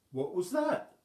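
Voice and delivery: high, loud startled question; wondering aloud. Acted. Wobbling hearing a cry outside, high and startled. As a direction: call out into the dark. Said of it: "she wondered".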